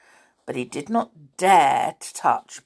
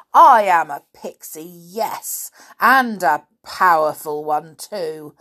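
loud, indignant pixie threats; bold; 3 semitones higher, 8 dB louder than usual. A narrator's reading. Pixie threatening to turn the Snoogle into a beetle, boldly. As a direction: boast boldly and threaten with spells. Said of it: "said Binky boldly".

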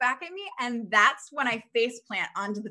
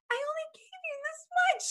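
much higher, disbelieving; incredulous friend. Retold or imitated. Friend telling Kirsten she gave only a little. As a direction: squeak it out like she can't believe it. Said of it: "And she said".

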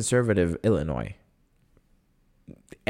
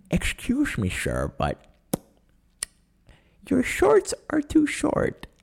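high, slow mock old man; old person voice; slower than usual. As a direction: drag it out like a scolding old man. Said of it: "this is my old person voice".

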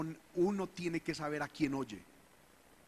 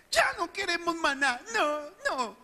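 much higher, louder, petulant whine; childish refusal; 13 semitones higher, 8 dB louder than usual. Retold or imitated. Preacher mocking people rejecting manna, childish high whine. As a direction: refuse like a toddler rejecting food. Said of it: "Pura actitud infantil".

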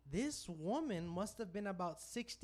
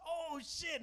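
retold oh shit; 10 semitones higher than usual. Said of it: "he goes".

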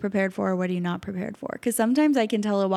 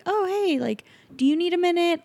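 gentle check-in; 9 semitones higher than usual. Retold or imitated.

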